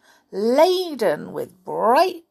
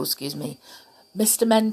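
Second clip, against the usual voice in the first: ordinary delivery, nothing marked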